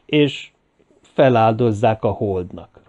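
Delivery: ordinary delivery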